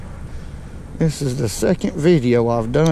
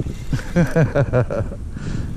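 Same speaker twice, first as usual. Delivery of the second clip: breathy excited chuckle over the fish; a quick giddy laugh. Real, not acted. Excited angler laughs at catch.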